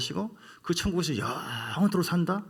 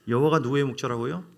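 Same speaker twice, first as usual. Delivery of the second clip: normal talking voice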